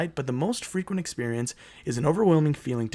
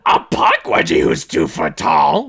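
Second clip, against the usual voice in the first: mock incredulous; 10 semitones higher, 6 dB louder, slower than usual. Mocking the story's premise.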